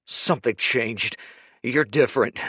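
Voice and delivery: growled. Acted. Kuruk confronting Dorian, growling. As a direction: growl the suspicion.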